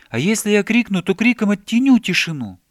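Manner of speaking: high, loud, eager exclaiming; childlike animated outburst; excited idea. A narrator's reading. Bear gets loud new idea.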